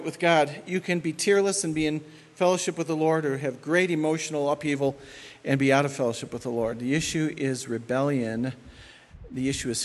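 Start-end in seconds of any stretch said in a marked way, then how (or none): none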